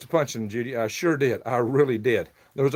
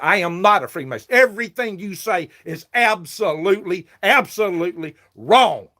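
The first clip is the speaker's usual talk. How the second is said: loud, high retold rebuttal; indignant retold comeback; angry retort; 6 semitones higher, 5 dB louder than usual. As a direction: fire it back at him, pitched up. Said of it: "I said".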